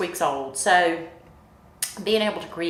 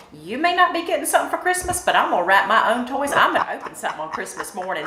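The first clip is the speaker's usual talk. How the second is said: put-on higher, quick little-girl voice, lightly done; little sister's voice; 6 semitones higher, 5 dB louder, faster than usual. Mimicking her little sister's defiant words. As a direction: play the determined little sister. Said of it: "She said".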